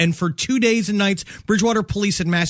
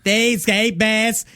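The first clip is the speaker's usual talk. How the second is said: bad Boston accent. Retrying the cops' lines, a mock Boston accent. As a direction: stretch the words in a failed Boston accent.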